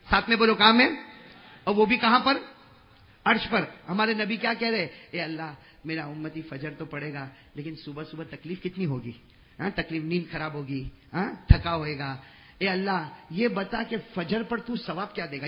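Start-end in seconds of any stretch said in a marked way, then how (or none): 5.1-15.5 tender plea. Acted. Prophet softly pleading with God for reward. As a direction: lower and much softer, pleading.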